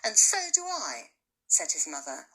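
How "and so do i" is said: higher, louder, firm declaration; strong, determined. Acted. Mother backs Bertie before her husband, determined. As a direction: stand your ground with your husband. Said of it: "her voice strong, determined".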